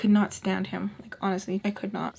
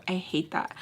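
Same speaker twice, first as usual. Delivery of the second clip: usual speaking voice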